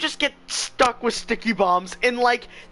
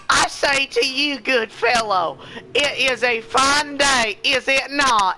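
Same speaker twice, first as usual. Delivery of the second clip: mock Southern accent; 6 semitones higher than usual. Shown as a demonstration. To friends, showing proper English in a Southern accent. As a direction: ham up a genteel Southern accent. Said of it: "saying it in, like, a Southern accent".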